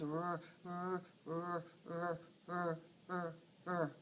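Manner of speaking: low rolled rrr like an engine; revving rrr. Acted. Teacher voicing a rabbit revving up to run. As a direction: rev up before the dash. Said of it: "he goes".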